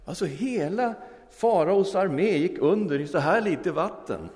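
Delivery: very high, loud triumphant exclaim; ecstatic punchline. Acted. Hippie telling the theologian the army drowned, ecstatic. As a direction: burst out with the miracle.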